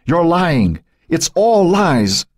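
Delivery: high, desperate accusation, drawn out at the end; frantic high-pitched denial; screaming. A narrator's reading. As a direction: cry it out to silence the tormentor. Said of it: "Joan screamed".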